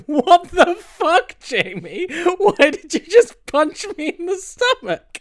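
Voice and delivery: high, drawn-out outraged yelp; shocked friend voice. Retold or imitated. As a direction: play the betrayed friend, squealing. Mock victim after a punch.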